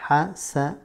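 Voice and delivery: HASANAT said syllable by syllable with pauses; découpé en syllabes. Shown as a demonstration. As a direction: break Hasanat into ha, sa, long nat. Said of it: "Tu entends une première syllabe". (Hasanat détaché syllabe par syllabe)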